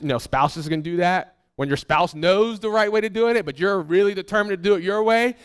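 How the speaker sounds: ordinary talk, nothing marked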